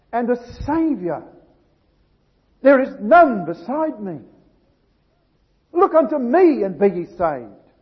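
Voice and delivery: rising to a loud, ringing proclamation; impassioned scripture reading. Acted. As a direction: preach the verses with mounting force. Preacher reads scripture at full force.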